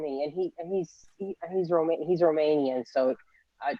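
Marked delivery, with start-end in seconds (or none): none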